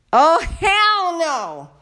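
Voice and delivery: slow, drawn-out, high and loud "hell no"; outraged. Real, not acted. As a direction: stretch it out in total disbelief. Furious at fake apology.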